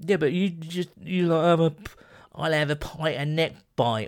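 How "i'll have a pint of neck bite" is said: lightly put-on cockney pub order; mock London accent. Mocking a mate's London bar order, lightly cockney. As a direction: drop into a bit of London geezer. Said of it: "you're doing accents now".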